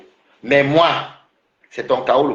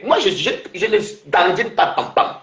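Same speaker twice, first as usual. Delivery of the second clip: usual speaking voice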